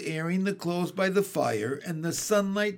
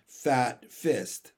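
ordinary delivery

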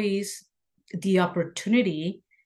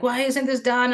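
retold yelling; 6 semitones higher than usual. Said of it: "I go out screaming at the shop".

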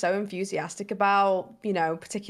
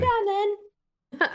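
high, slow coaxing; 12 semitones higher, much slower than usual. Real, not acted. Coaxing the dog.

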